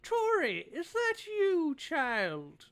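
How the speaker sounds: put-on high, loud frail old lady voice; an elderly healer calls out, high and loud; old woman. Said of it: "and she kind of goes".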